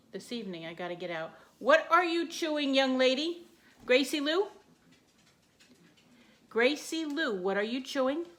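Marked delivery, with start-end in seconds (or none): 1.6-8.3 high, loud mock scolding of a puppy; louder, higher voice for the dog; pet scolding. Real, not acted.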